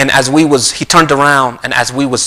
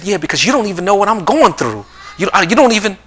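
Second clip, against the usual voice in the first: lightly voiced daughter, pitched up; daughter venting; 7 semitones higher than usual. Daughter tells parent they don't understand, higher-pitched venting. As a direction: imitate a daughter protesting in a higher voice.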